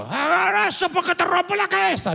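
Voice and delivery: put-on high, sharp voice of a blunt father threatening a child; harsh father. Pastor imitating his blunt father scolding him, high and sharp. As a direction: bark it like a fed-up dad. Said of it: "Mi papá era básico".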